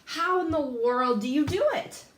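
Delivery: ordinary talk, nothing marked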